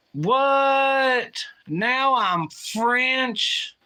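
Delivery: high, loud, stretched-out outbursts of real shock; shocked disbelief. Real, not acted. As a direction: react like the results just slapped you. Streamer reacting to ancestry results.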